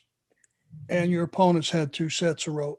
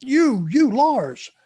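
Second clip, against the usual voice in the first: higher, louder call across the arena; 6 semitones higher, 6 dB louder, much slower than usual. Retold or imitated. Bill Watts singling him out in stands, mock shout. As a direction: point him out, half-shouted.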